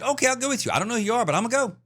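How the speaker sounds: high-pitched, blithely agreeable mimicry; mock her going along without a thought; lightly sarcastic high character quote; mock naive heroine. Mimics movie heroine readily leaving.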